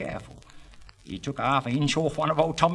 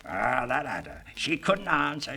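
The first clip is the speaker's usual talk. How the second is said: ordinary talk, nothing marked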